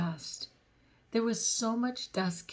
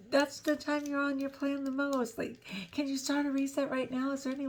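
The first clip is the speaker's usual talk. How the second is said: higher-pitched mock pleading; husband pressing his wife to restart, a hint of panic; imitating panicked husband; 6 semitones higher than usual. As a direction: voice the husband urging a reset, lightly. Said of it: "He was just like".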